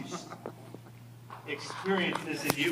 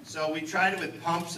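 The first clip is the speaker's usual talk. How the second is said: ordinary delivery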